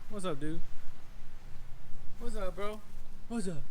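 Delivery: high friendly greeting to the dog; pet talk. Real, not acted. Greeting Maverick the dog, high friendly pet voice. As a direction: pitch it up like greeting a buddy dog.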